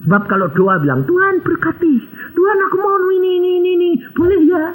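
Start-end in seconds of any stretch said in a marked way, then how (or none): 1.1-4.7 mock greedy prayer.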